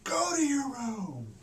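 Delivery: high, slow mock stern father with a laugh; half-laughing imitation of an angry dad; slow, high-pitched playful dad command; stern dad order. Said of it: "my dad said".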